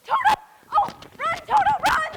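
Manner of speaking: high, loud cry to run; urgent shout. Acted. Dorothy shouting to Toto to escape, urgent. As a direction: yell it after the dog.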